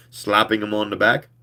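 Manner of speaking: plain reading voice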